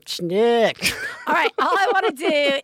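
ordinary talk

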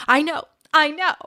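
high, loud mock exasperation; almost exasperated. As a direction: imitate someone fed up with being told. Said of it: "sometimes almost exasperated".

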